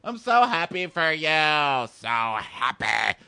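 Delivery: slow, drawn out mock cheer dying off; fake happy, fading. Mocking the jealous girl, slow and forced. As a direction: drag it out, forced happiness fading. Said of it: "Swinging arms".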